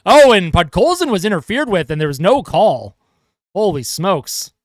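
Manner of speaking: steady hockey play-by-play call; live broadcast commentator voice; play-by-play commentary. Acted. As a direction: call the game for the viewers.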